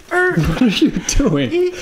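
high, slow, drawn-out disbelief; incredulous. Real, not acted. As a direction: stretch it in disbelief. High drawn-out surprised question.

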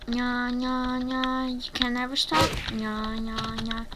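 sing-song nya-nya teasing; mock-villain taunting, stretched vowels; playful childish taunt. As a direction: gloat in a drawn-out chant. Taunting the players with nya-nya chant.

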